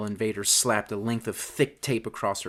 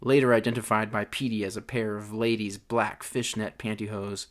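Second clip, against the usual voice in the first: plain reading voice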